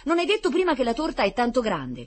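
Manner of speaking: high, loud, eager question; excited squeal. A narrator's reading. Blond boy reminding Rita of the huge cake, excited squeal. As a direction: keep squealing with enthusiasm. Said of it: "strillò il biondino saltando per l'entusiasmo".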